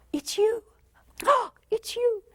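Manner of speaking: high, breathless delight; thrilled high it's you. Retold or imitated. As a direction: gasp and light up at seeing them. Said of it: "every time we see them, it's like".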